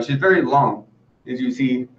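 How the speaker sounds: ordinary delivery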